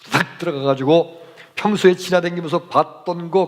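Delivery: ordinary talk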